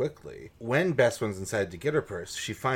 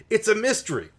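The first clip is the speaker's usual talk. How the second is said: ordinary delivery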